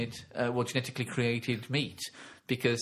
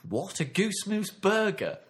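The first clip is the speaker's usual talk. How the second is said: mock disbelief; 7 semitones higher than usual. Incredulous barman.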